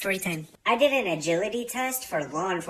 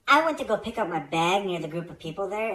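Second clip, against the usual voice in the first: usual speaking voice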